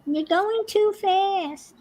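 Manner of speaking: very high, slow whiny complaint; complaining bingo player. Retold or imitated. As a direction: squeak out a grumpy protest.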